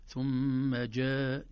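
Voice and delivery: ثم جاء chanted slowly with held madd; stretched tajweed reading; recitation. Shown as a demonstration. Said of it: "الحرف ينحبس إذا سكناه".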